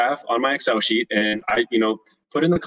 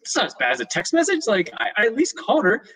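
higher, whiny protest; defensive protest; 11 semitones higher than usual. Retold or imitated. Husband protesting to his wife, higher and whiny. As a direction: defend yourself to her, pitched up.

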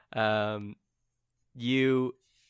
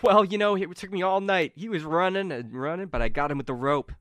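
lightly raised, casual bragging voice; boasting Carmen; 7 semitones higher, 6 dB louder than usual. Acted. Carmen tells companions how she roped him, lightly boastful. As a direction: recount the chase with modest pride. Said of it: "And she's like".

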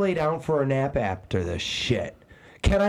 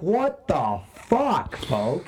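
drawn-out exasperation; much slower than usual. Retold or imitated. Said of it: "So I'm like".

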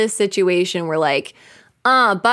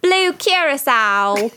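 slow, posh-leaning British Blue Curacao; hammed-up British Blue Curacao; mock British accent; 5 semitones higher, slower than usual. Hosts try out the British Blue Curacao, mock British accent. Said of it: "Are we British?".

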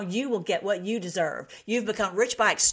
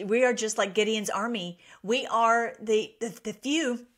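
ordinary talk

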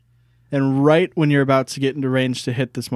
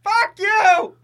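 high yelled insult; shouted insult; 4 dB louder than usual. Acted. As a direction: yell it back while running. Fleeing lizard shouts back.

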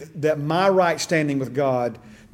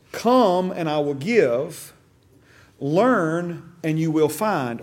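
slow scripture quote; 3 semitones higher, much slower than usual. Acted. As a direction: lay each phrase down slowly like a preacher. Repeats the pattern slowly.

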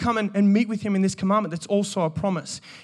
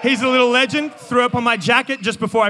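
loud, high proud cry; proud shout; 4 semitones higher, 4 dB louder than usual. Real, not acted. Proud shout about son.

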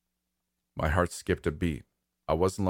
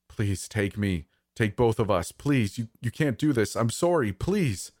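beg, more urgent with each phrase; desperate pleading; 4 semitones higher than usual. Acted. Dad offering himself in the child's place, pleading. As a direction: pitch climbing to a high final please. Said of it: "my dad's voice pleading".

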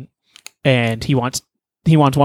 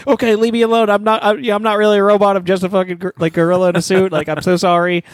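higher, meek voice dropping the robot act; sheepish and apologetic, a bit higher; gorilla's real voice; 5 semitones higher than usual. Retold or imitated. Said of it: "it's not my real voice".

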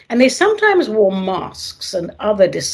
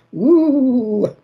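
spooky woo; 6 semitones higher than usual. Acted. Spooky exclamation on paranoia.

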